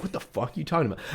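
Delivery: fast, dropped-volume bewildered blurt; confused rush. Retold or imitated. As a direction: rattle it off in disbelief. Confused waiter retort voiced.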